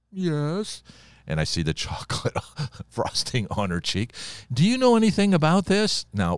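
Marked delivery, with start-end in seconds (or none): 0.1-0.8 put-on high, tiny child's yes; a small guilty girl owning up; little girl's meek yes. Daughter admits to her father, high tiny yes. 4.5-6.1 much higher, louder, playfully feigned ignorance; mock-innocent question. Father teasingly questions his caught daughter, high mock innocence.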